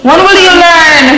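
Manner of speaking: mother yelling at her daughter; angry scolding. Acted. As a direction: demand an answer from the child.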